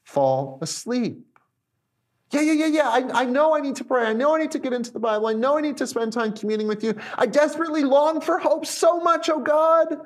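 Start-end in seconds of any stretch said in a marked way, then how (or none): 2.3-10.0 fervent protesting. Acted. Believer assuring God of good intentions, mock-fervent protesting. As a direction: gush good intentions, ending in mock-desperate longing.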